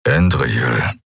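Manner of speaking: Keru growling her name, low; a low grumble. A narrator's reading. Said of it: "brummte er".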